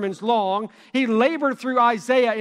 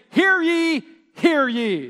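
loud ringing herald cry, higher; town herald; 6 semitones higher, 4 dB louder, slower than usual. Retold or imitated. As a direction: call it out like a royal herald. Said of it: "trumpet a horn and then gather the people and say".

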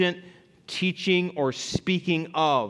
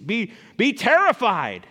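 mock terror; 4 semitones higher, 4 dB louder than usual. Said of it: "I would imagine the response would be".

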